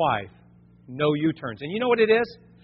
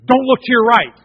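loud, high-pitched urgent warning; sudden warning; 6 semitones higher, 9 dB louder than usual. Acted. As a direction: blurt it out like an alarm.

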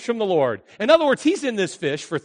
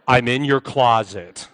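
low, slow, ominous answer; deep, deliberate punchline voice; voice of God; 8 semitones lower, 4 dB louder, slower than usual. Retold or imitated. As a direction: intone it deep as a mock God. Answers screaming girl as God.

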